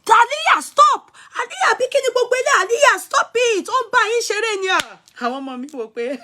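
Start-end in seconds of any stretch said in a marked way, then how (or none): 0.1-5.0 high, loud frantic shouts at the dog; grandma shouting at dog. Retold or imitated. Grandma retold yelling at the jumping dog, high and loud. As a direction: yell the dog's name high and hard, panicked. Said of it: "When my grandma was saying".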